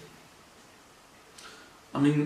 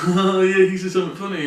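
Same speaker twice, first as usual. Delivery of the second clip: loud, higher mock amusement; imitated laughing crowd; 4 semitones higher, 7 dB louder, faster than usual. People reacting to a joke he missed, imitated amusement. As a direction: play people cracking up at a joke.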